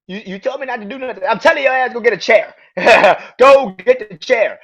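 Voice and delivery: high, loud mock yell of an angry boss; yelling order. Roman yelling at Sami.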